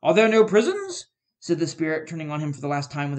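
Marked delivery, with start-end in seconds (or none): 0.0-1.1 loud, biting retort; scornful. A narrator's reading. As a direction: throw his words back at him. Spirit throws his words back.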